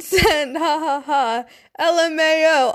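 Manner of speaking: usual speaking voice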